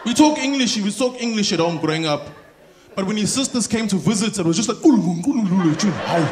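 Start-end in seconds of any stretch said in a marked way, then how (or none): 4.8-6.2 mock Zulu chatter. Visiting sisters chatter at home, mock Zulu gabble. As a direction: babble like relatives chattering in Zulu.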